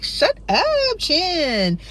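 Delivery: very high squealing shut up; embarrassed squeal. Retold or imitated. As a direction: squeal it, flustered. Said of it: "And I'm just like".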